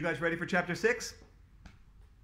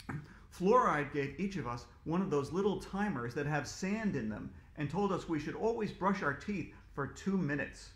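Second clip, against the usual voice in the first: plain reading voice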